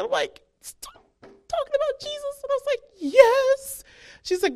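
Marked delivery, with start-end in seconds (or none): 0.6-2.4 very high, slow mock whimper; crying. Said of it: "crying, and I'm in traffic". 3.0-3.8 high-pitched mock wail; hammed-up tearful admission; crying. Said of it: "crying, and I'm in traffic".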